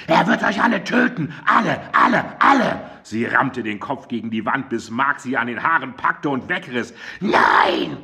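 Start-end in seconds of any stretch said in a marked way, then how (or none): 0.1-2.8 much higher, rising to a frantic cry; panicked threat. A narrator's reading. Arrested woman raving in panic. 7.2-8.0 rasping scream. A narrator's reading. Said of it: "kreischte Mandy weiter".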